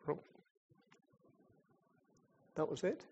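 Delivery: ordinary talk, nothing marked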